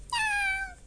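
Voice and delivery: un miau agudísimo de gatito; maúlla como un gatito; maullido de gatito. Acted. El gatito contesta. (maullido muy agudo de gatito)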